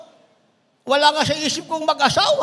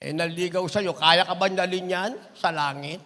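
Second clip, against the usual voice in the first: ordinary talk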